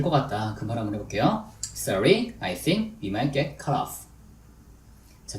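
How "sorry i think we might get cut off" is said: slow, with pauses between chunks; deliberate, phrase-by-phrase English demonstration; slow drill model. Shown as a demonstration. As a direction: model it slowly for learners to repeat. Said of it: "천천히 해볼게요".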